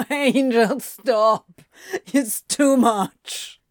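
laughing, protesting plea; giggly protest, slowing at the end; helpless laughter. A narrator's reading. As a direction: beg for mercy through laughter. Helpless laughter at cheesy lines.

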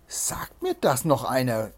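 ordinary delivery

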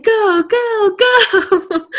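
high drawn-out urging, ending in laughter; mock urgent cheering. Viewer urging him as he wheels them out, playful urgency. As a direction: egg them on like an excited viewer.

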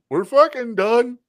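put-on high, loud, slow punch-drunk groan; a beaten man wheezing out his surrender; dazed beaten fighter. Beaten challenger to Bruce Lee, punch-drunk and high. Beaten guy admits defeat.

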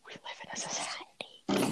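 slow, breathy mock-deep whisper; mock profound whisper. Quoting the society meme to his friend, in a slow mock whisper. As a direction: say it like a weary meme philosopher. Meme line after a sigh.

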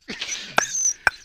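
unvoiced wheezing laugh; breathless laugh. Real, not acted. Laughing at the memory with his co-host, breathless wheeze.

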